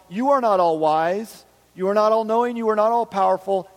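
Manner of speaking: loud, high mock accusation; grumbling complaint. Grumbler telling God he failed, acted loud complaint. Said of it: "grumbling and complaining is saying".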